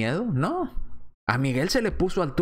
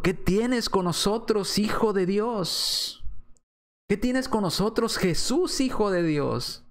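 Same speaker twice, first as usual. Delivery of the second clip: higher, drawn-out quoted plea; mild crying out; 6 semitones higher, slower than usual. Retold or imitated. Preacher voicing demons addressing Jesus, mild crying out. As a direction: imitate a cry, pitched up without shouting. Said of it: "Y clamaban diciendo".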